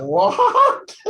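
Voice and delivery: high, loud laughing disbelief; incredulous laughing outburst. Acted. Teacher reacts to absurdly formal English, incredulous. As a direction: squawk it high through laughter.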